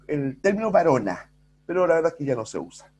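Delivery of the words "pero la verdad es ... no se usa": normal talking voice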